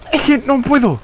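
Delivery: high whine of giving up; whiny frustration. Real, not acted. Gamer failing the parkour jumps whines high in frustration. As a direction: moan it in a high pitched whine.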